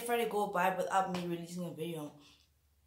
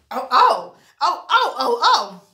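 startled cries; 4 semitones higher, 15 dB louder, slower than usual. Real, not acted. Burning from spicy food.

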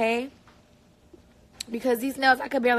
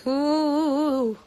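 normal talking voice

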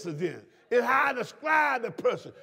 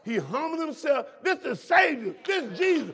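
rising preaching; 4 semitones higher, 3 dB louder than usual. Acted.

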